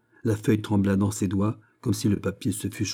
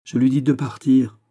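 higher, louder firm reply; reviving; 5 semitones higher, 7 dB louder than usual. A narrator's reading. Young woman telling the duke he should go, spirited. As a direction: answer with recovered spirit. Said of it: "en se ranimant".

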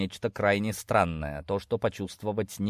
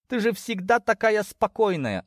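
indignant mother; 12 semitones higher than usual. Acted. Mother shocked at her daughter's behaviour, indignant. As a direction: scold your child in a raised pitch.

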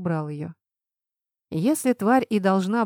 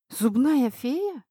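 higher, slow incredulous question; drawn-out bewildered question; taken aback; 5 semitones higher, much slower than usual. A narrator's reading. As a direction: ask slowly, dumbfounded. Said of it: "опешила я".

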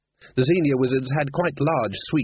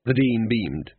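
plain reading voice

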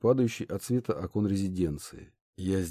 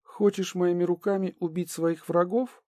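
distrustful princess; 10 semitones higher than usual. A narrator's reading. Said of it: "злобу и недоверие в голосе".

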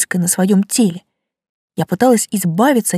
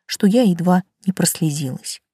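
plain reading voice

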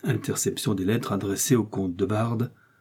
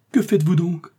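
high, louder cry of disbelief; pitched-up, startled protest; startled exclamation; 9 semitones higher, 5 dB louder than usual. A narrator's reading. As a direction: cry out the question in alarm. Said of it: "s'écria Ketty".

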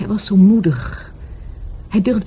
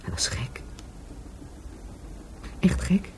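whisper; 13 dB quieter than usual. Acted. Describing her dead lover.

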